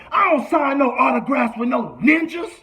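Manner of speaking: loud, high rapper yell; furious shout. Retold or imitated. Rapper yelling at the fan who begged for an autograph. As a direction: bark the refusal in his face. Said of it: "looks at Rich and says".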